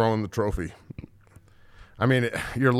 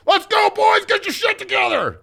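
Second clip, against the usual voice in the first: very high, loud screaming yell; coach yell; 5 dB louder than usual. Retold or imitated. Coach yells at the half-deaf twins, screaming high and loud.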